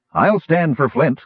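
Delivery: mild cry, volunteering at once. A narrator's reading. Friend backing the dwarf in a duel, crying out. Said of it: "Caramon cried".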